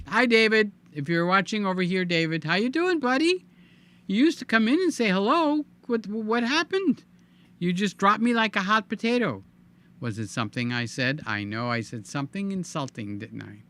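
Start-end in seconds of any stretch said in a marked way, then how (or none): 0.1-9.4 higher, playful mock-offended tease; lightly hammed-up hurt feelings; mock hurt teasing. Real, not acted. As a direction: pretend to be jilted by a buddy. Teasing a viewer who stopped visiting.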